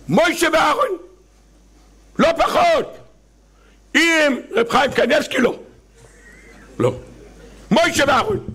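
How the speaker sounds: high, stubborn repetition; mock insistence. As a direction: insist like a stubborn old rabbi. (עקשנות מלגלגת בקול גבוה)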